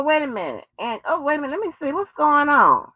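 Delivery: normal talking voice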